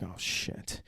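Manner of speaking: breathless frightened whisper; scared whisper. Retold or imitated. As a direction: hiss it under your breath in fear. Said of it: "Miles is scared".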